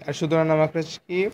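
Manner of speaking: ordinary delivery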